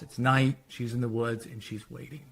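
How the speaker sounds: ordinary talk, nothing marked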